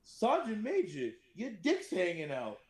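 high, slow deadpan with a laugh breaking in; Magulik's reply. Retold or imitated. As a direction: deliver it innocently, cracking up at the end. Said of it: "Magulik looks at him and goes".

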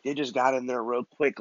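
ordinary delivery

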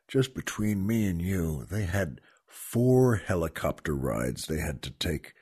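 dropped low and soft, just between us; confidential aside. Real, not acted. Host confiding to listeners about the helicopter rides, hushed. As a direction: lean in and confide it quietly. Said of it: "Just entre nous".

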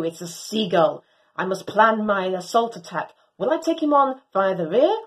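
usual speaking voice

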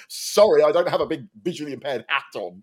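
loud, high sarcastic reply; sarcastic retort. Retold or imitated. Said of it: "It's like".

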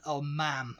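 usual speaking voice

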